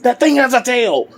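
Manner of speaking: high-pitched, alarmed outburst; mock alarm. Pa Kent objecting to the tailed baby, mock alarm. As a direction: act out a freaked-out husband, voice shooting up.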